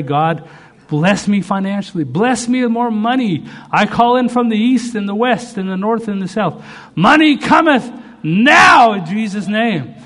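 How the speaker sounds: high, building to a booming command; mock prayer, crying out for money with long-held words; demanding prayer.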